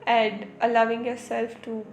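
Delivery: soft, unhurried soothing talk, like leading a meditation; calming spiritual talk. Real, not acted. Speaker guiding viewers into stillness, soft meditative voice. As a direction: ease the listener into calm, gently.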